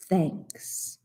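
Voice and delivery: soft breathy whispered thanks; a hushed final thanks. Acted. All say thanks softly.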